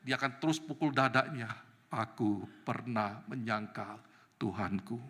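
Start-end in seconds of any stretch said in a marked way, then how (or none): 1.9-5.0 slow, low, quiet lament; subdued retold remorse; remorseful confession. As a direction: confess it slowly, heavy with regret. Retold Peter's remorseful self-accusation.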